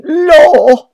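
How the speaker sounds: very high, loud anguished cry; a sharp high scream of grief; grief-stricken cry. Acted. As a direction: wail it out in sudden awe. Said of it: "she cried in grief and sudden awe".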